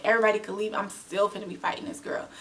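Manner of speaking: normal talking voice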